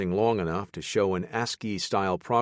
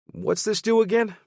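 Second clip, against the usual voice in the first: Baker's voice; 5 dB louder than usual. Acted. Baker asks about device.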